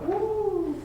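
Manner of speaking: a high swooping woo that mimics a crescendo; rising woo. Teacher imitating an amazed audience member, high swooping woo. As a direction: sing the woo up high and bright. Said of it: "can say, Oh, I heard that go".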